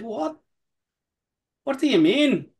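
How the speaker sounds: a bad Scottish accent playing the distiller's confusion. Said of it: "please don't try and do a Scottish accent".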